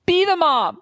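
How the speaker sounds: put-on high, pleading child's demand, the second slow; a small child ordering her mother to take charge; insistent little girl. Twin Megan telling her mother to act like the mom, high insistent child voice. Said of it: "She's telling me to be the mom".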